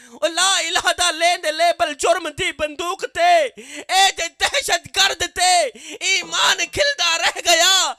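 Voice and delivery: loud, slow declaimed Punjabi verse; impassioned poem recital. Acted. Preacher reciting a Punjabi poem to the congregation, loud and slow. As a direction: declaim each line with mounting force.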